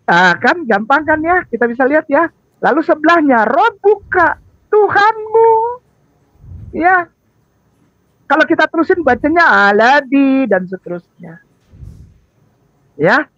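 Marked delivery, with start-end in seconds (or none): none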